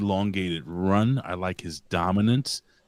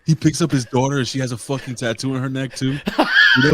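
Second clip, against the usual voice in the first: loud, raised voice breaking into laughter; laughing; 5 semitones higher, 5 dB louder, faster than usual. Real, not acted. Co-host adding to a wrestling joke, laughing. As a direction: crack up while piling on the joke.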